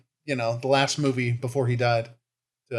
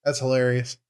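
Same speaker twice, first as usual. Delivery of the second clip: ordinary talk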